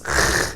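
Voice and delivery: CH as a throaty rasp; hard German ch. Shown as a demonstration. Teacher shows the harder sound, hard German ch. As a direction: scrape CH from the back of the throat. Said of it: "it's a little bit harder".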